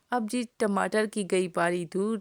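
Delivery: ordinary talk, nothing marked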